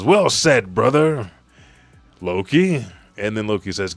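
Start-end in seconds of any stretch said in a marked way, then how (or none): 0.0-2.9 loud, higher heroic voice; hearty acted call. Warrior greeting his brother in battle, loud hearty call.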